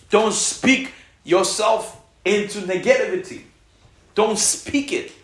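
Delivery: slow, high, loud command; forceful warning. Real, not acted. Preacher warning the church, slow loud command. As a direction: drive the warning home slowly.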